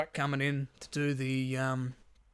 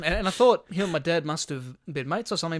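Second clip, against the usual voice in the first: usual speaking voice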